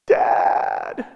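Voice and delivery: breathy unvoiced cry of a scared child; whispered child's cry. Preacher imitating a child in distress, hushed whispered cry. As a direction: call out for mom and dad like a frightened kid, but hushed. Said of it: "children cry out".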